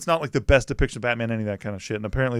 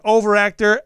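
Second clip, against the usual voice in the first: higher, louder mock jab; retold call-out; 9 semitones higher, 8 dB louder than usual. Actress ribbing her co-star in an outtake, retold call-out. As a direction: call it out like a heckle.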